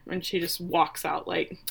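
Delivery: ordinary talk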